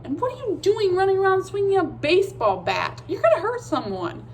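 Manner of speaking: put-on high, sharp girl voice telling him off; Molly scolding Daniel for swinging a bat. A narrator's reading. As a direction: scold him like a bossy big sister.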